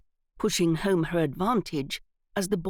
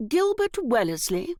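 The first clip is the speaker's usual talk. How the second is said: haughty imitation; 7 semitones higher, 3 dB louder than usual. A narrator's reading. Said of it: "in her best imitation of Aunt Em's haughtiest intonation".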